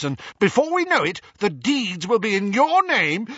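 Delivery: loud, high furious rant; ranting uncle. A narrator's reading. Vernon ranting about plot.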